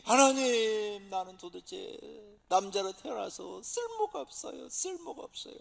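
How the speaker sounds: a touch of wailing, dragged out; man weeping in a fasting prayer; mock wailing prayer. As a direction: cry out to God as if weeping. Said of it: "막 통곡하는 거죠".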